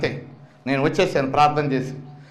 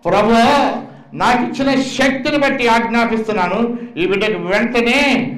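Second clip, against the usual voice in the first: raised, ringing prayer of command; 3 semitones higher than usual. Retold or imitated. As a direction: order the illness out in prayer. Commanding prayer over coma child.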